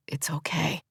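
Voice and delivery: soft, breathy reassuring whisper; gentle whisper. A narrator's reading. Soothing the crying woman with a gentle whisper. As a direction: hush her gently, barely voiced. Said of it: "he says in a gentle voice".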